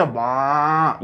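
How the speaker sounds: low, soft mooing baa; cow moo imitation. Shown as a demonstration. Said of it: "अब गाय कैसे आवाज करती है". (गाय जैसी धीमी, गहरी बा)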